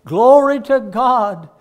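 high, slow, loud cry of praise; loud glory. Retold or imitated. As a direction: ring it out like a trumpet. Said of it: "and he begins to say".

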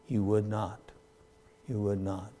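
hushed lament. Acted. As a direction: drop to a soft sorrowful hush on would not. Preacher repeats Jesus' words.

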